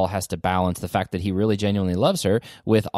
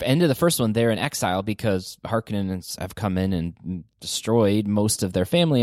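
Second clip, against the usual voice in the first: ordinary talk, nothing marked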